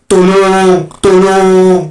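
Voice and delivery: loud, high cry for help; drawn-out high distress shout; yelling for help. Acted. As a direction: yell out as if calling for rescue. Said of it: "Jangan teriak, 'Tolong".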